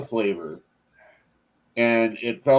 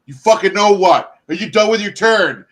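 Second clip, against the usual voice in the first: much louder and higher, fed-up shout; mock exasperated yell; 9 semitones higher, 9 dB louder than usual. Retelling yelling at a stoned friend at cards, loud exasperated shout. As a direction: bark it at a friend who won't play.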